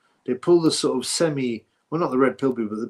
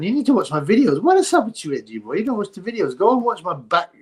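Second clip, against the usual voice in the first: high, loud, exasperated rant at the commenter; exasperated scolding; 6 semitones higher, 3 dB louder than usual. Real, not acted. Ranting at a commenter who hasn't watched his videos, exasperated. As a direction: tell him off, incredulous.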